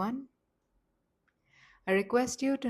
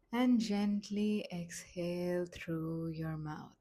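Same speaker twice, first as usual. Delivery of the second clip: slow, gentle meditation voice with long pauses; softly paced relaxation instructions, leaving space to breathe; guided meditation; 4 dB quieter, slower than usual. Acted. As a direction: guide them calmly, phrase by unhurried phrase.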